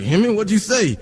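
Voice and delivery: [name]'s lingo. Retold or imitated. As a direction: mimic his run-together lingo, asking. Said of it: "his lingo at first".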